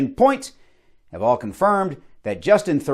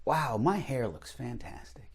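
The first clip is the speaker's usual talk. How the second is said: much softer, admiring himself; soft vain aside; 3 semitones lower, 13 dB quieter than usual. Acted. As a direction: murmur it to a mirror.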